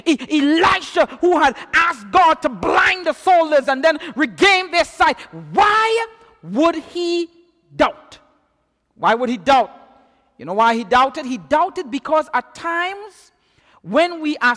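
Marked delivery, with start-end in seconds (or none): 5.5-8.2 slow rhetorical question. Real, not acted. Preacher presses the congregation on why he doubted, slow and drawn out. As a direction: stretch out "Why would he" slowly, then land "doubt?". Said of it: "drawn-out rhetorical repeat".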